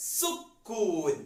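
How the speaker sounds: very slow, stretched model word; drawn-out new word. Shown as a demonstration. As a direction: present the new term slowly. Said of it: "വട്ടത്തിന് പറയുന്ന പേരാണ്". (പുതിയ വാക്ക് നീട്ടി സാവധാനം)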